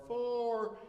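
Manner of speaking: high, held-out warning call; mock yell across a fairway; golf warning yell. Shown as a demonstration. Warning golfers of a ball hit too far, high call. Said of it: "you're supposed to yell four".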